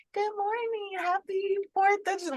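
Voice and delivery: high singsong greeting; imitated cheery message in a high voice. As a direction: chirp a holiday greeting, pitch way up. Reads out a friend's cheery message.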